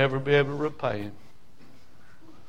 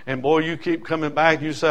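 ordinary talk

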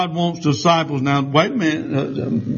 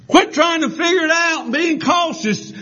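high, shouted sermon line; high ringing preacher shout; full-force preaching; 11 semitones higher than usual. Acted. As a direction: preach it out hard.

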